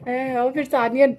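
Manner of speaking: usual speaking voice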